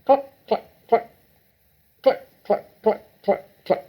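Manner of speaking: high clucking hen sounds; a big brown hen settling in the yard. A narrator's reading. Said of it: "went cluck".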